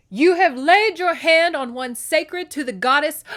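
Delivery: high, loud, outraged goddess; shrieked accusation. A narrator's reading. Outraged mother accuses Zeus before the gods, shrieking. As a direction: accuse him at full voice. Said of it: "Demeter shrieked".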